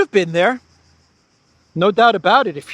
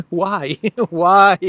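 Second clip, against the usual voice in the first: laughing why; 3 semitones higher, 6 dB louder than usual. Real, not acted. Laughing in disbelief.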